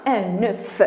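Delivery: œuf with an audible f; final F pronounced. Shown as a demonstration. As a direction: sound the F ending œuf. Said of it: "F se prononce". (œuf avec le F prononcé)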